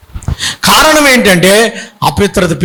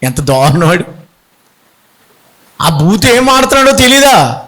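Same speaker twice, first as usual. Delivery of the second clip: normal talking voice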